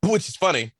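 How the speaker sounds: choking a bit on food. Real, not acted. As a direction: clear the throat, still choking on food. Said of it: "I'm choking here 'cause I was trying to wolf down food".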